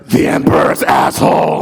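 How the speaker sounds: low, rough imitated film-actor voice; Christopher Lambert impression. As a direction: drop it down into a growled movie line.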